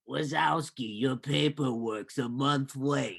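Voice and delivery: slow, drawn-out character voice; Roz. Acted. Clerk chiding Wazowski over late paperwork, Roz impression. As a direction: imitate a monster clerk, dragging the words. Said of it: "that was an amazing impression".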